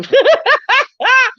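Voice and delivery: high-pitched burst of laughter; real helpless giggle; laughing. Real, not acted. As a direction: crack up at your own slip. Laughing at her own slip.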